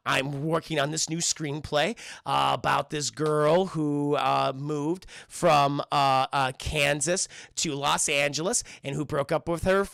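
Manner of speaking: slow, hesitant, with drawn-out words; stretched-out, searching delivery; halting pitch. Retold or imitated. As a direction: feel your way slowly through the explanation. Voices Penny haltingly describing screenplay.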